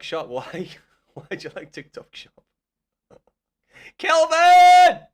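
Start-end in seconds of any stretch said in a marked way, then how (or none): 4.0-5.0 huge disbelieving shout of the name; yelling the name at the chat; outraged yell. Real, not acted. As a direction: bellow the name in shock.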